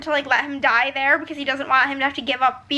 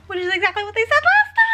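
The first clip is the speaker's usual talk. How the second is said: fast, higher and louder; excited rush; 8 semitones higher, 5 dB louder, faster than usual. Real, not acted. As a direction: gush in realization. Excited realization.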